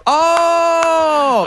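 long, high whooping "oh"; crowd's recognition "oh". Retold or imitated. Comic imitates the friends realizing who he is, high drawn-out whoop. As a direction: hold a high cheer of sudden recognition.

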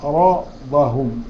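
عرضهم said slowly, the ra and dad heavy; tajweed demo of عرضهم; slow recited word. Shown as a demonstration. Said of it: "عند الراء والضاد مفخمة".

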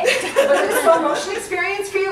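high, loud laughing question. Real, not acted. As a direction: ask while laughing out loud.

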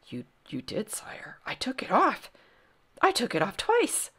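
timid voice rising in protest; flustered protest. A narrator's reading. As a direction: start hushed and faltering, end high. Flustered boy answers the angry king.

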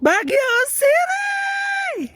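long drawn-out high shout. Real, not acted. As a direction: belt it out across the valley. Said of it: "Ay, ang ingay ko".

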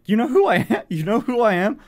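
high, loud, laughing disbelief; stunned disbelief. Retold or imitated. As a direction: blurt it out, starstruck and giggling. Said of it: "I immediately just went".

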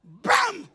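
sharp mock bam with a pitch jump; preacher's bam as she strikes; mock yell. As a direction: slap the word out like a hit. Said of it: "she said".

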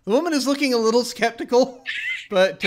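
ordinary delivery

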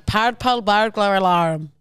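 slow, laboured attempt at Scottish; put-on Scottish accent. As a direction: sound out each word in amateur Scottish. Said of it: "I'm putting on an accent".

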